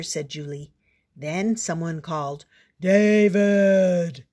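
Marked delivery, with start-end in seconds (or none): 2.8-4.2 loud call from down the street; father calls his son home. Acted. Father calling David home, loud shout.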